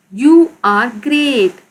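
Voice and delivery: each word drawn out for the class; slow, deliberate model sentence; slow read-aloud. Shown as a demonstration. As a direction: read it slowly, word by word, like a teacher. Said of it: "आप अजीम हो".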